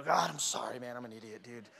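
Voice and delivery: low, hushed retold apology; sheepish apology. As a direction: back down softly, owning up to God. Retold sheepish apology after anger.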